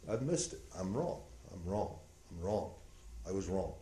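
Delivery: soft, hushed admission; barely above a murmur; quiet confession. Acted. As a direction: say it broken and low. Remnant heart confessing.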